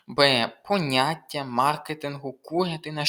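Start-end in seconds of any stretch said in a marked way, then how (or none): none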